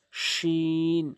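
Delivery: শিন held long and slow; drawn-out letter name. Shown as a demonstration. Teacher reciting letters for students. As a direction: stretch শিন out. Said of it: "তিন আলিফ করে টেনে পড়বেন".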